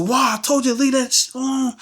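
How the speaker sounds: high-pitched scolding mother; mom snapping. Retold or imitated. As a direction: snap at your son like a fed-up mom, voice up high. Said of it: "she just snapping on me".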